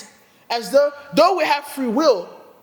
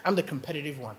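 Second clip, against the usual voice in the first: usual speaking voice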